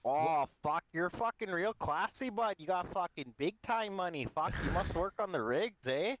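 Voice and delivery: put-on Alberta hoser accent. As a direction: play up a hockey-bro Alberta accent. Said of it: "Alberta accent".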